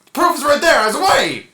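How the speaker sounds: high, loud indignant yell; excited shout. Pretend. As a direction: shout it like it's obvious. Mock defense, shouting.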